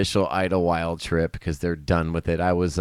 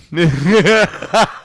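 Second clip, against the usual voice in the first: hearty laugh at the punchline; loud laugh; 8 semitones higher, 8 dB louder than usual. Real, not acted. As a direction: let the laugh go.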